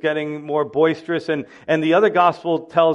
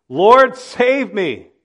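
cry for help; 4 semitones higher, 7 dB louder, much slower than usual. Pretend. Peter sinking cries out.

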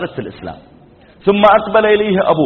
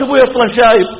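high, raised brush-off; imitated dismissive wife; 6 semitones higher, 4 dB louder, faster than usual. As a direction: toss it off eagerly in a high pitch. Wife brushes off father's death. (تقليد زوجة غير مبالية بصوت مرتفع)

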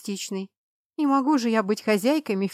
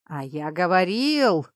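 gleeful; 3 semitones lower, slower than usual. A narrator's reading. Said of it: "радостно сообщил".

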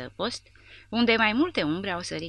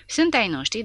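defiant retort; 6 semitones higher, 6 dB louder than usual. A narrator's reading. Porta defies the lieutenant.

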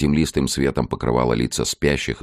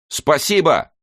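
high loud grateful shout; shouting over machinery; 4 dB louder, slower than usual. Acted. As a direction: yell thanks over the train noise. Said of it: "прокричал Мика".

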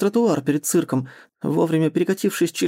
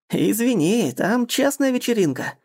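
higher, slower, teasing voice; mock him from behind; 6 semitones higher than usual. A narrator's reading. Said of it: "знакомый насмешливый голос". (насмешливым голосом)

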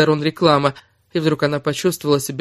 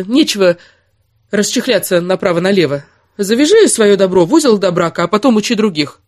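1 tag only: angry rant; 6 semitones higher, 6 dB louder than usual. Acted. Kira rants at Erik.

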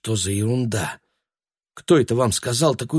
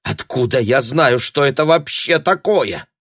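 raised pitch, slowing at the end; exasperated reply; 6 semitones higher, 4 dB louder than usual. Acted. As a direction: protest it, baffled and annoyed.

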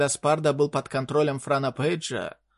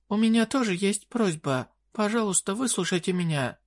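higher, pleading fairy queen voice; desperate fairy queen; 6 semitones higher than usual. Acted. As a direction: beg in a high desperate voice. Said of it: "говорившая более высоким, чем обычно, тоном".

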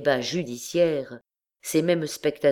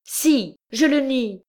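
high, loud fierce denial; rising up in furious defiance; terrible tone; 11 semitones higher, 4 dB louder than usual. A narrator's reading. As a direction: hurl the denial back at the judge. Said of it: "dit-elle avec un accent terrible".

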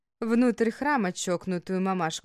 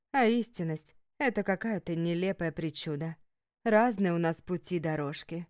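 ordinary delivery

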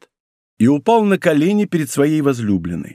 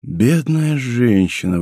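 slow, drawn-out lament; hopeless pity, not fully whispered; despairing murmur; 3 semitones lower, slower than usual. A narrator's reading. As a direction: grieve slowly, in understated despair. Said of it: "в отчаянии шептал Атос".

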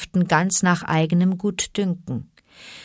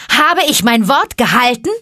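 loud, higher triumphant question; calling out; 7 semitones higher, 9 dB louder than usual. Acted. Mowgli asking the pack, loud and high. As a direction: call it across the rock. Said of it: "rief Mowgli".

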